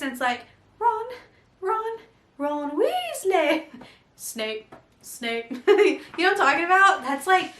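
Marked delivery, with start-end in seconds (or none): 0.8-3.6 higher, singsong puppet calling a name; puppet voice impression. Imitating puppets from an old viral video, singsong. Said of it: "it's just the puppets". 4.2-5.5 low, soft deadpan puppet voice; puppet Snape impression. Imitating puppets from an old viral video, low deadpan. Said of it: "it's just the puppets".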